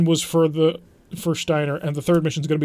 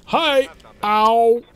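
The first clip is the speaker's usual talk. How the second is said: pained yelp; 6 semitones higher, 7 dB louder than usual. Real, not acted. Mech gets hit unexpectedly.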